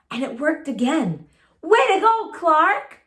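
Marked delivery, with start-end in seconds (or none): 1.6-3.0 high, loud friendly cheer. Acted. Friends praising Clark at lunch, high loud cheer. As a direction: cheer him on brightly. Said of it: "said his friends".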